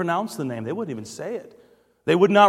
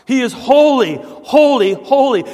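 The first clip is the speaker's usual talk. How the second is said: loud, high preaching repetition; emphatic proclamation; 7 semitones higher, 7 dB louder than usual. Real, not acted. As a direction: drive each word home, raised and forceful. Preacher emphatically echoes the seraphim's cry.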